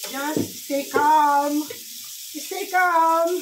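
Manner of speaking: slow, loud, long-held CALM; drawn-out calming call. Real, not acted. Vlogger trying to settle angry crabs, drawn-out calming call. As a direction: stretch out CALM and hold it loudly.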